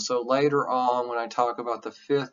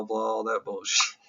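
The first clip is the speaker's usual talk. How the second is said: ordinary talk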